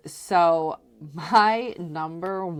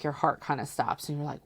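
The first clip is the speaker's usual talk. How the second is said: ordinary talk, nothing marked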